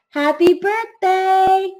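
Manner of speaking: high, loud, drawn-out birthday greeting; party guests chorusing happily; celebratory cheer. A narrator's reading. Guests singing to Norman, in narration.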